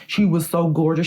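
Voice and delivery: usual speaking voice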